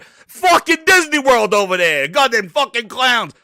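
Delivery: loud, high-pitched comic outrage; worked-up mock-furious shouting; exasperated rant. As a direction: rail at them as a bunch of clowns. Ranting at Microsoft after the bit.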